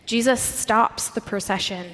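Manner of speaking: usual speaking voice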